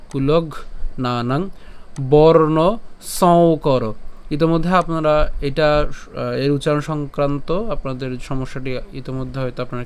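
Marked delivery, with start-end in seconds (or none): 0.1-3.9 very slow, drawn-out "কুলঘ্নানাং বর্ণ সংকর"; pronunciation demo. Shown as a demonstration. Teacher shows how the written phrase sounds, slow drawn-out demonstration. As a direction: stretch each word of "কুলঘ্নানাং বর্ণ সংকর", pausing between. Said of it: "তাহলে এটা হবে কেমন?".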